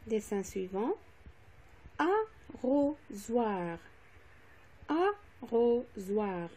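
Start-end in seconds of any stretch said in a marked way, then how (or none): 2.0-3.8 "arrosoir" very slow, in three separate syllables. Shown as a demonstration. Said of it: "dans la deuxième syllabe". 4.9-6.5 "arrosoir" very slow, in three separate syllables. Shown as a demonstration. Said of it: "dans la deuxième syllabe".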